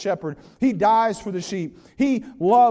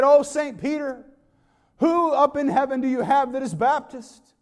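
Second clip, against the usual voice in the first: mock call to Saint Peter; 5 semitones higher, 4 dB louder than usual. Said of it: "mocked this idea of God".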